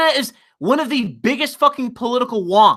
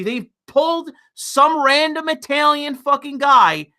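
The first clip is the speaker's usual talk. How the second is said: ordinary talk, nothing marked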